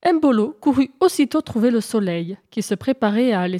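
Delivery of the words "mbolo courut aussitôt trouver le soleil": ordinary delivery, nothing marked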